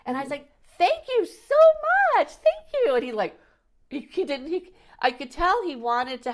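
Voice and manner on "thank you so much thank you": high and louder, sing-song thank you; loud cheerful thanks. Retold or imitated. Shouting thanks across at the driver who asked again. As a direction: call it across so he hears, beaming.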